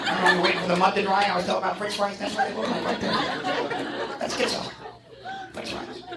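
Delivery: high, excited strange visitor voice; high, childlike wonder, trailing to a whisper; mudman from Borneo. Retold or imitated. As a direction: marvel at the pictured fries. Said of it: "mudmen from Borneo are coming in".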